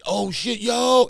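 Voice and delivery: high, mock-excited hailing; excited greeting. Old schoolmate calling your name, acted excited greeting. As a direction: act out spotting an old friend.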